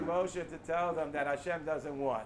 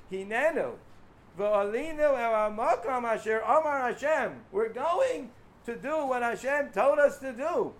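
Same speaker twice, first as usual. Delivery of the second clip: fervent declaration; 5 semitones higher, 5 dB louder than usual. Retold or imitated. Quoting the people's resolve.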